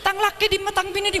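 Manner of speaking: high, rapid monotone cry; frantic high-pitched babbling; mock panicked wail. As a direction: cry out fast in a high put-on voice. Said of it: "bedeken labu aleng-leng".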